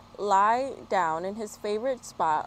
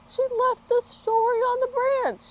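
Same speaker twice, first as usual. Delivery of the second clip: much higher, put-on dismayed voice; dismayed dog; 5 dB louder than usual. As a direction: squeak it out in disbelief. Dog finds story left.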